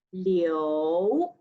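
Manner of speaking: "liu" rising from low, second tone. Shown as a demonstration. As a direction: slide "liu" upward. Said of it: "Line number fourteen".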